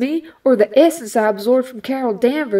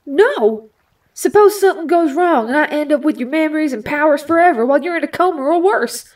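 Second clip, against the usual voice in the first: frightened refusal; 5 semitones higher than usual. Acted. Rogue refusing Storm's offer, frightened. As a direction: panic rising as she refuses.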